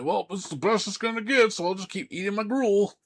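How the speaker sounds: high, dopey put-on voice; hammed-up simpleton voice; mock resigned fan. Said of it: "And you're like".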